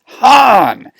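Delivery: high, loud scolding cry of a name; stern, frustrated. Acted. Leia smacks Han on the head, stern and frustrated. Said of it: "in a stern and frustrated voice".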